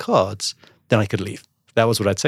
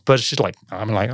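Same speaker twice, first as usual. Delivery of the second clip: ordinary talk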